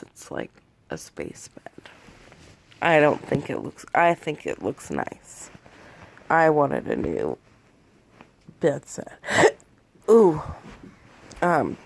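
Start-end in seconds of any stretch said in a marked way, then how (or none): 0.0-1.9 hushed, much lower and softer; whispered quote. Retold or imitated. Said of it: "he said mostly it's the sheets". 9.3-10.1 sharp high gasp ooh; delighted gasp ooh. Real, not acted. Delighted gasp at the new bed.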